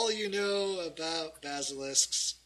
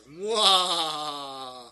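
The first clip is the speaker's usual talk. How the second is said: drawn-out villainous "mwah"; hammed-up villain cackle; evil laugh; 5 dB louder than usual. Villain laughing behind the adventurers, evil laugh. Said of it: "you hear evil laughter".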